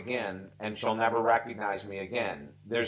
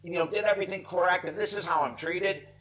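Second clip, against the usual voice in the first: raised, pitched-up frustrated rant, half acted; mock car scream; 6 semitones higher than usual. Stuck in traffic, cursing the missed flight, mock frustrated outburst. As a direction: imitate someone venting alone in the car. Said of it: "they might scream in the car and say".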